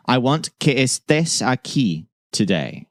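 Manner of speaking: slow, careful Spanish phrase; slow model sentence. Shown as a demonstration. Teacher presenting the basic sentence slowly to students. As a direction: lay each word out slowly for learners. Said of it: "So our basic sentence is".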